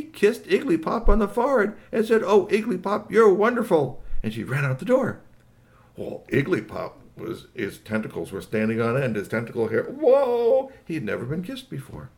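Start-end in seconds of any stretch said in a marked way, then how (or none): 2.2-4.0 swooning, high-pitched praise; gushing. Acted. Kiss and gush in a story. 9.8-10.7 high, loud whoa of amazement; bowled-over, high-pitched exclamation; amazed. Acted. Alien startled by first kiss.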